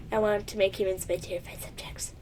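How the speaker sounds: breathy, hushed, sinister; mock-evil dragon whisper; villainous whisper.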